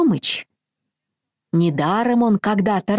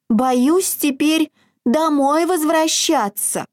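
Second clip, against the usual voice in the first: high, timid hare voice; scared little hare; 8 semitones higher than usual. A narrator's reading. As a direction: tremble like a frightened bunny.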